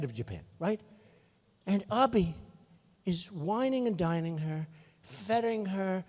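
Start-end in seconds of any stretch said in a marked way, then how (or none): none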